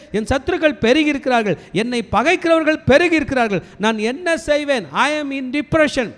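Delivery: high-pitched preacher's version of a despairing cry; retold anguished lament. Preacher voicing David overwhelmed by growing enemies, retold anguished lament. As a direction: raise the pitch on an imitated lament. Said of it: "இப்பேற்பட்ட துயரத்தோட இருந்திருப்பாருன்னா".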